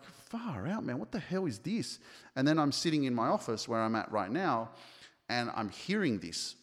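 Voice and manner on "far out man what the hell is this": much higher, incredulous retold outburst; annoyed at the frog calls keeping him up; exasperated disbelief. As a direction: throw it up high like you can't believe the noise. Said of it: "And I was like".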